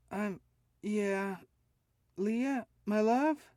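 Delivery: high, loud worried mother; anxious mother voice. A narrator's reading. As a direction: check on your child, frightened. Mother checking on daughter.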